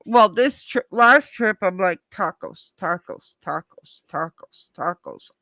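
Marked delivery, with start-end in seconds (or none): 2.1-5.3 low, soft, evenly repeated word; quiet, low looping mumble to yourself; muted self-reminder. Retold or imitated. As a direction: repeat it under your breath like a private chant. Re-enacts repeating word to herself.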